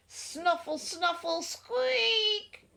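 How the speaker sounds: high little snuffly sounds rising; snuffling squeak. A narrator's reading. Narrator voicing creature outside the door, snuffly squeaking. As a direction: sniffle it high, ending in a squeak.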